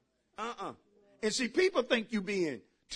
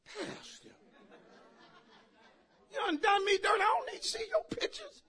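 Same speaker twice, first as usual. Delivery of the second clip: mock whiny, much higher hurt voice; hurt churchgoer; 13 semitones higher, 4 dB quieter than usual. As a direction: play the offended congregant. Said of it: "Somebody looking like".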